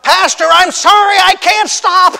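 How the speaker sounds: full high panicked holler, hammed up. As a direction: yell it like an out-of-control skier. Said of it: "he hollers out".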